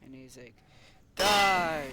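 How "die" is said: loud, drawn-out villain shout; pretend attack shout. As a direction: shout it as a play-acted death blow.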